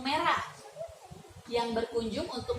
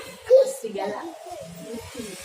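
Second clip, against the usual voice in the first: serigala; 7 semitones higher, 5 dB louder, slower than usual. Acted. Wolf voice exclaim.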